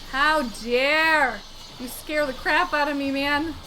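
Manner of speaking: mock outrage.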